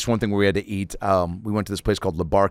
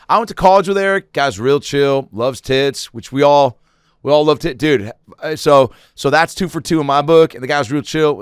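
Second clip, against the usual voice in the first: louder, higher, lightly hammed-up endorsement; frat brother vouching; 4 semitones higher, 6 dB louder than usual. Stoned brother vouching for a pledge at the meeting, lightly acted. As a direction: speak up for a pledge before the meeting. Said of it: "brotherhood meetings where they would break down".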